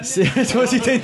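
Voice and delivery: laughing through the words, pitch jumping up; giggling fit. Real, not acted. Reviewer caught by the giggles, laughing through his words. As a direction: break down in real giggles mid-sentence.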